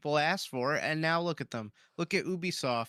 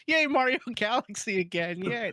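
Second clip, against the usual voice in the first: mock cheer of a fan, higher and louder; Nintendo fans cheering; 5 semitones higher, 3 dB louder than usual. Mocking Nintendo fans clapping for a rerelease, mock cheer. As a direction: cheer like a mindless fan with a laugh. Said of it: "your impression of Nintendo fans".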